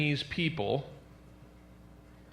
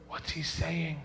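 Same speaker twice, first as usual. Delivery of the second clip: quiet aside; 8 dB quieter, slower than usual. Acted. Traveler asking a stranger about the shouting man, quiet and discreet. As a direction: ask it under your voice.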